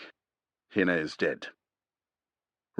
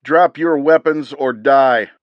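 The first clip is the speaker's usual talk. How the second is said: loud, slow, higher threat; commanding shout; 7 semitones higher, 10 dB louder, slower than usual. A narrator's reading. Unseen commando threatening the two men, slow and loud. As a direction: bark the ultimatum slowly. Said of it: "an American voice".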